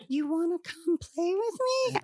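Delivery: high, sweet luring doll; creepy doll voice. Retold or imitated. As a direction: invite them in like a haunted doll. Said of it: "in a doll voice".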